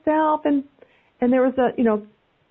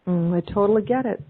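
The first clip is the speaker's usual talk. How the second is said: normal talking voice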